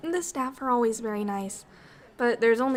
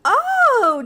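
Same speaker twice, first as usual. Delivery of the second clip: surprised; 13 semitones higher, 10 dB louder than usual. Acted. Reacting to hearing jogging.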